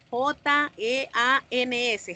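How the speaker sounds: J E A N S spelt out slowly, letter by letter; spelling out. Shown as a demonstration. Teacher spells the word out for the class. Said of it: "se escribe jeans".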